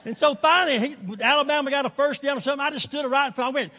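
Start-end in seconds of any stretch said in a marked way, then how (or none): none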